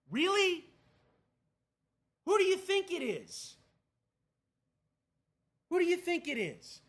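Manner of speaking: loud, high-pitched disbelief; incredulous exasperation. Acted. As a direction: throw the question back as if it's obvious. Exasperated reaction to Paul's question.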